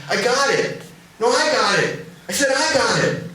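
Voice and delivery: high, eager shouts to each other; calling out. Acted. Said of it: "call out".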